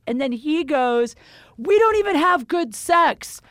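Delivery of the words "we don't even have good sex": high, emphatic imitation of an irked boyfriend; mock angry retort. Boyfriend rejecting her plea to leave, mock angry retort. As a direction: throw it back as an exasperated complaint.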